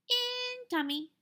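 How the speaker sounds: put-on very high little-kid voice; lightly voiced toddler answering his brother. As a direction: squeak it like a toddler. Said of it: "Fudge laughed".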